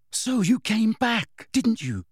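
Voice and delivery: high, drawn-out urgent question; urgent boyish insistence. A narrator's reading. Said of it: "said Harry urgently".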